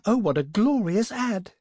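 put-on excited young woman's cry; delighted cry. A narrator's reading. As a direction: exclaim over it with relish. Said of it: "cried the other".

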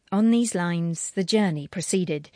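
ordinary delivery

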